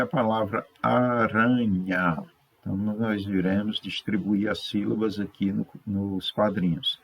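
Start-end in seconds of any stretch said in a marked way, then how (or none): 0.8-2.2 ARANHA drawn out, a-ra-nha; syllable by syllable. Shown as a demonstration. Teacher introducing the first word aranha, syllable by syllable. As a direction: stretch each syllable of aranha. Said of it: "A primeira sílaba, né, que é o A".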